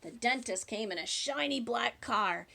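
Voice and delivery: usual speaking voice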